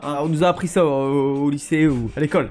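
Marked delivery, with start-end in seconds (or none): none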